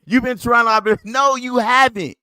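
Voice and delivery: usual speaking voice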